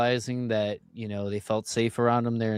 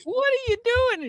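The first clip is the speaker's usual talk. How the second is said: much higher mock lady's voice. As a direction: squeak it out like a startled lady. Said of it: "some lady".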